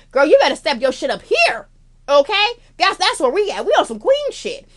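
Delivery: sassy hype. Real, not acted. Hyping up the audience.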